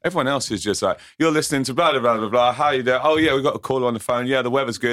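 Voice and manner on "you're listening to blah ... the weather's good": lightly voiced cheesy DJ patter, a touch higher and louder; mock radio presenter. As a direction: rattle it off like a bland breakfast presenter. Mocking bland radio presenter.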